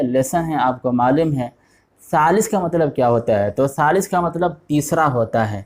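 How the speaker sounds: ordinary talk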